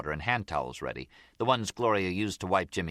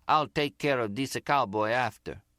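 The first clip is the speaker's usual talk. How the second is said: mock Italian accent, a little higher; imitated Zip's accent; 5 semitones higher than usual. Gloria quoting Tommy's threat to Nicky, in an imitated Italian accent. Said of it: "giving her version of the Zip's accent".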